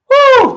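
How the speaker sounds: loud, high whoop; piercing whoop after a set of push-ups. Real, not acted. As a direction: let out a big high "woo". Exertion whoop during push-ups.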